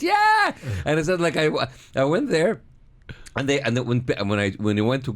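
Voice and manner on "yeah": high, loud whoop; crowd cheer. Retold or imitated. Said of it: "everybody went".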